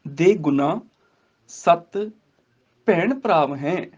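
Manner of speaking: word groups set apart, resting on ਸੱਤ; pause after ਸੱਤ. Shown as a demonstration. Teacher showing the light stop, recitation paused at ਸੱਤ. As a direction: halt briefly after ਸੱਤ. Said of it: "ਸੱਤ ਤੇ ਹਲਕਾ ਜਿਹਾ ਰੁਕਣਾ".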